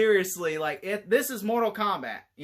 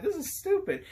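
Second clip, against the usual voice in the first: exasperated; 12 semitones higher than usual. Retold or imitated. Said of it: "I'm like".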